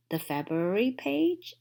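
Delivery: plain reading voice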